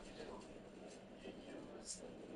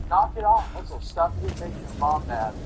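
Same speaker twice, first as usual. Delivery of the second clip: loud angry knock it off; angry yell; 13 dB louder than usual. Acted. Dad yelling at his son to stop, loud and angry. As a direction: bark it at the kid.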